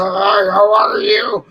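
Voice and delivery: slow, loud put-on man's voice greeting; deep mock man's hello. Sibling [name] greeting a stranger in a slow loud manly voice. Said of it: "I said".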